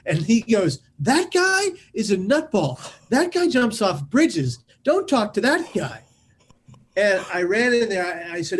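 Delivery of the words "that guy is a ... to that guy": put-on high, drawn-out alarmed kid; acted emphatic warning about a nutcase; exasperated warning. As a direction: warn someone off, loudly scandalised. Kid warns cousin about narrator, acted.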